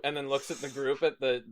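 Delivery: ordinary delivery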